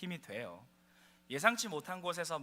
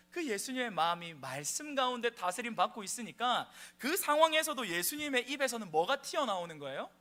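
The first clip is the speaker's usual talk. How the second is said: higher, louder impassioned sermon; fervent preaching; 9 semitones higher, 11 dB louder than usual. Acted. Pastor urges the congregation about Jesus, high and loud. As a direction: drive the message home with rising fervor.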